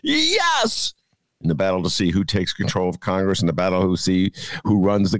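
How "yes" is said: high cheering yes; cheering at a headline, way up high; excited yes. Retold or imitated. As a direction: cheer it out, much higher. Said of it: "I'm like".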